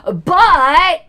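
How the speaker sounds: loud, high, drawn-out BUT; mock emphatic but. Critic quoting a tweet, mockingly emphatic. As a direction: pounce on the word with mock drama.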